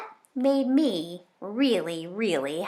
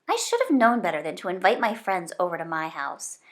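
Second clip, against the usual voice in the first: plain reading voice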